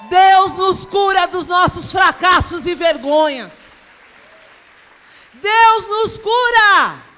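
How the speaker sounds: slow, drawn-out loud shout; high, thunderous sermon climax; full-force preaching. Real, not acted. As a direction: proclaim it to the whole congregation.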